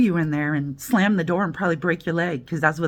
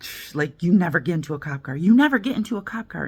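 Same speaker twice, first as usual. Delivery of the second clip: fast, insistent, stress on never; hissed hurried warning from her own heart; urgent inner warning; faster than usual. Retold or imitated. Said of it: "my heart is like".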